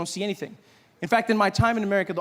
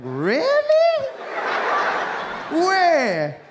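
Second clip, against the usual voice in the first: very high, loud disbelief; astonished; 12 semitones higher, 5 dB louder, much slower than usual. Retold or imitated. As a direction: squeak with mock amazement.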